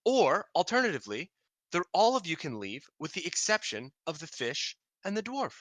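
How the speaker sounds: a hint of a queen offering terms; lightly voiced queen. Queen offering the party an alternative, lightly regal.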